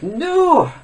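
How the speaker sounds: high, whiny mock impression; long high-pitched no, then insist; PewDiePie. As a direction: ridicule a rival in a put-on voice. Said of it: "This is me doing my impression of PewDiePie".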